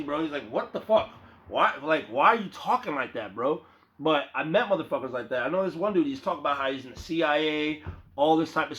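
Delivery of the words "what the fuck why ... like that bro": usual speaking voice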